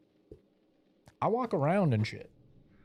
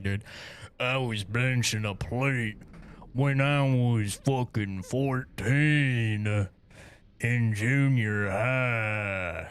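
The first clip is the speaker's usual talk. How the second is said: drawn-out scornful bro voice; slow mocking gym bro; 2 semitones lower, much slower than usual. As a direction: sneer it out slowly. Voicing mocking gym guys.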